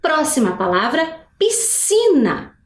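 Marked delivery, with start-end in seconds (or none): 1.4-2.6 "piscina" slow, with one long s sound; demonstrated pronunciation. Shown as a demonstration. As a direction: model "piscina" slowly and high. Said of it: "Vou fazer a pronúncia de novo". ("piscina" devagar, com um som único de s)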